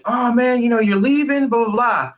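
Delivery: high, pleading barfly voice; mock the guy begging him to stay; lightly voiced pushy drunk; pushy stranger. Bar stranger voiced in story.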